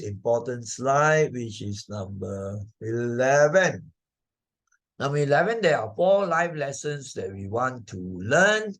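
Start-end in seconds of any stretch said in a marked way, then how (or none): none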